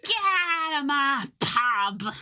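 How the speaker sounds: high, drawn-out British pub-landlord wail; mock British yell. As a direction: bawl it slowly in a caricature British accent. Playing an angry British pub landlord.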